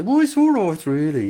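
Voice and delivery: Brummie leaping high, then dropping; Birmingham accent. Retold or imitated. Birmingham local coaxed to sound jolly, still downbeat Brummie accent. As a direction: imitate a Brummie who won't sound jolly. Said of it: "try to make them sound jolly".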